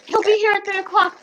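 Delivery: high, eager gushing voice; excited girl's voice. Retold or imitated. Retelling an eager store girl, high and gushing. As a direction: gush it out like a girl who can't stop talking. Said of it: "And she says".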